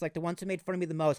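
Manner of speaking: ordinary talk, nothing marked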